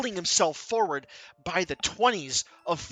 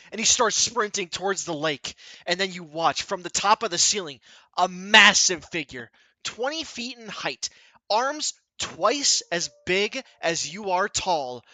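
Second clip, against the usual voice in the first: usual speaking voice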